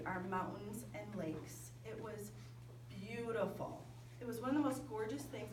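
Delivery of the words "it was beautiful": ordinary talk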